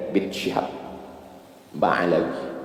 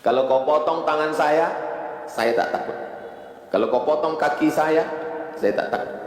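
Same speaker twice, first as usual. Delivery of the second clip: defiant zealot; 9 semitones higher than usual. Retold or imitated. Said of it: "Kata Abdurrahman bin Muljam".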